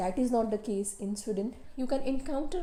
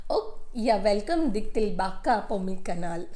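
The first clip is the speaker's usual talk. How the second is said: playful put-on Swedish accent; cheery, louder Swedish accent greeting; 4 dB louder than usual. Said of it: "in my very funny Swedish accent".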